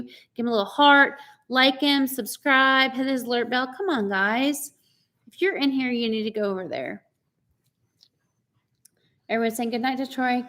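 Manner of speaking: ordinary talk, nothing marked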